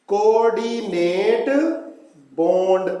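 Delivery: drawn-out term, word by word; stretched syllables with a pause between words; slow dictation. Real, not acted. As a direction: say it slowly for students to take down. Teacher introduces and dictates new term.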